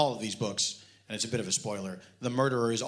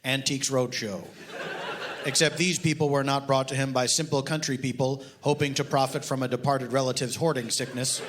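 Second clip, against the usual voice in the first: deadpan reading of a mock detective novel; story reading; 3 semitones higher than usual. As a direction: read it dry, like a serious audiobook. Author reads his novel aloud.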